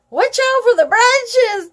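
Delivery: high calling voice; called out. Acted. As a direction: warn the rider from behind. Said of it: "Tori called out".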